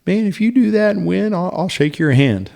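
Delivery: high-pitched, mildly amused retelling; amused half-laugh. Retold or imitated. Prosecutor kinda laughing at a self-represented defendant, amused. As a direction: imitate someone half-laughing, pitched well up. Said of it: "he kinda laughed".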